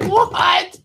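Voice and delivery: high-pitched, shouted disbelief; shocked yell. Retold or imitated. Storyteller reliving hearing Ann Coulter scold single mothers, shocked yell. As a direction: yelp it in stunned disbelief. Retold shock at outrageous remark.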